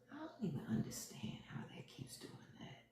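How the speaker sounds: voiceless whisper to herself; whispered aside. Real, not acted. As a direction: whisper it under your breath, puzzled. Muttered aside about screen.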